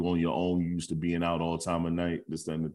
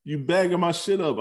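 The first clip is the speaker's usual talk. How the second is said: raised, insistent complaint, easing slightly; mock yelling; 9 semitones higher, 4 dB louder than usual. Son arguing with father over belongings, mock yelling. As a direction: holler it over him as a put-on protest. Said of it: "I got, I'ma yell".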